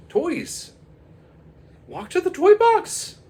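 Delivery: put-on high, loud kid order; boy firmly commands the toys; commanding kid.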